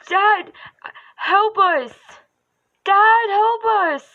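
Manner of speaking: high, loud frantic plea; panicked kid cry. Pretend. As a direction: call for help in a panic. Voice acting a cry for help.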